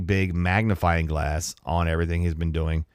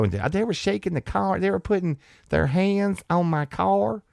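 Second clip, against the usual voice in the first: mock whiny complaint; 12 semitones higher than usual. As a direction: whine like a spoiled victim. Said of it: "hers is just that whiny".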